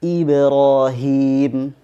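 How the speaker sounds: slow tajweed Ibrahim, thin b; thin qalqalah. Shown as a demonstration. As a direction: Ibrahim with the b bounce kept thin. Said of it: "pada kata".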